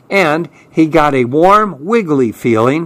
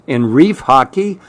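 ordinary delivery, nothing marked

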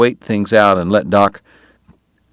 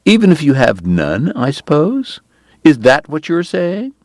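needling jab; 8 semitones higher than usual. Acted. Kate needles Doc.

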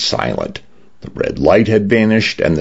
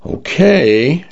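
hesitant, higher-pitched agreement; trepidation; 10 semitones higher than usual. A narrator's reading. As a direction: say it with mild trepidation. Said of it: "Dinah said with trepidation".

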